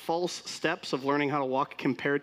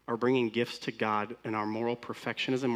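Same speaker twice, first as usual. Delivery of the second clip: ordinary talk, nothing marked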